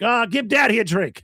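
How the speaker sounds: lightly voiced drunk, pitched high; hammered drunk guy. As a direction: imitate a pushy drunk asking for a round. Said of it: "he's hammered".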